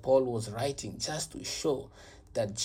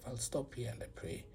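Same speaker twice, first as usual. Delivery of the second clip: much softer, trailing into a whisper; soft whispered close; fading whisper; 13 dB quieter than usual. Real, not acted. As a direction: let it fade to a whisper. Closing remarks fading into whisper.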